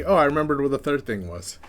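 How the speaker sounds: usual speaking voice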